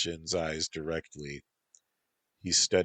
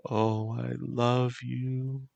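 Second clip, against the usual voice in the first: slow, high tender sigh; 7 semitones higher, slower than usual. Acted. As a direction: let it stretch out, aching. Lovers in the dark.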